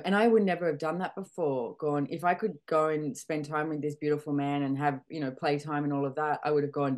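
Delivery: usual speaking voice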